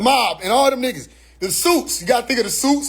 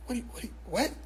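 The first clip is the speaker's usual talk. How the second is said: very soft, stammered bewilderment; hushed, acted confusion; baffled sputter; 3 semitones higher, 14 dB quieter, much faster than usual. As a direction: stumble quietly over the question, stunned. Acting baffled caller brushed off.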